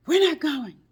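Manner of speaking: high, loud panicked cry; frantic quote. Retold or imitated. Friend on the phone after a crash, panicked cry. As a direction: blurt it out in alarm. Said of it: "No, she didn't say, "We're not going".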